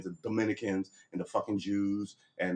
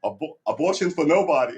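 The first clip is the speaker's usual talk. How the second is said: loud, high rally-speech announcement; cartoon politician; 5 semitones higher, 6 dB louder than usual. Kang answering the crowd, loud and high pitched. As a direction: proclaim it from the podium. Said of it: "that Kang Kronos thing on The Simpsons".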